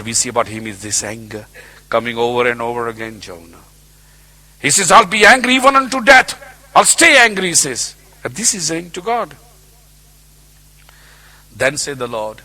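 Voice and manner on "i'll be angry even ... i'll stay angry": defiant, higher and louder; stubborn prophet. Acted. Jonah telling God he'll stay angry, defiant. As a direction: insist on your anger.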